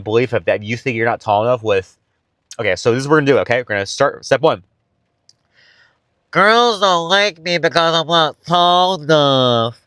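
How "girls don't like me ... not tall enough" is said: slow, higher, dopey mocking whine; stupid voice. Shown as a demonstration. Step one of mocking the belief, slow stupid voice. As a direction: stretch every word to make it sound dumb. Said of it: "say it in a stupid fucking voice".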